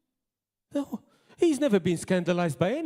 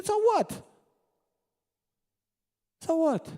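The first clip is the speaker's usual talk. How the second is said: defiant so what; 11 semitones higher than usual. Real, not acted. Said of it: "dismissing the accusers".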